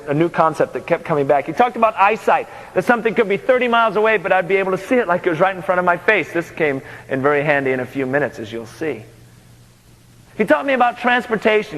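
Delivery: usual speaking voice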